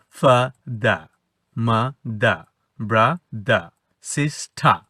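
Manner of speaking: slow "ফা দা, মা দা, ব্রা দা, সিস ঠা" without final r; British silent R. Shown as a demonstration. Teacher demonstrates British rule, final r dropped. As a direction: end each of "ফা দা, মা দা, ব্রা দা, সিস ঠা" on an open vowel. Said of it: "আরটা British নিয়মে উচ্চারণ হবে না".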